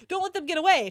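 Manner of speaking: shouted order. Acted.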